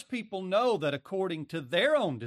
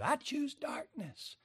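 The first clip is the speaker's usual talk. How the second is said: defiant sinner voice; 12 semitones higher, 5 dB quieter than usual. Acted. Voicing the rebellious flesh.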